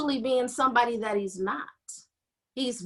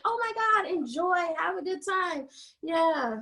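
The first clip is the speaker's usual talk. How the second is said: much higher, forced bright well-wishing; faked happiness; 5 semitones higher than usual. Jealous coworker forcing much higher, fake cheer. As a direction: fake a big happy send-off. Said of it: "she had to fake it too".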